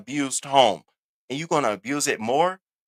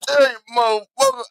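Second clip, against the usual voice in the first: imitating Tommy stammering; 3 semitones higher than usual. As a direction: do Tommy tripping over his curses. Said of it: "And Tommy".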